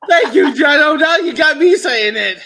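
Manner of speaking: loud laugh through words. Real, not acted. Laughing hard after the bit.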